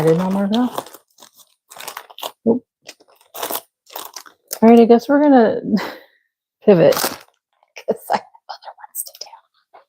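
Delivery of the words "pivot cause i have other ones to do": slow giggly whisper; laughing whisper. Real, not acted. Laughing at her own mistake.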